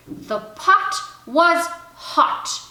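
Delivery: reading aloud. Acted. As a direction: read each word out clearly and slowly. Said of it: "pretended she was reading to him".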